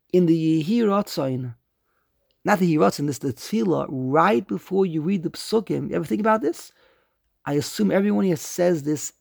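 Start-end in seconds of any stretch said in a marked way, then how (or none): none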